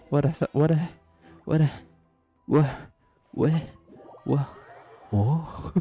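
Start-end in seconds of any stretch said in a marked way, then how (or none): none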